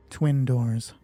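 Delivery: ordinary talk, nothing marked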